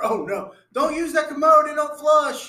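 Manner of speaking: movie character warning about the toilet; Dumb and Dumber quote. Retold or imitated. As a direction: blurt the warning in a panic. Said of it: "that scene from Dumb and Dumber".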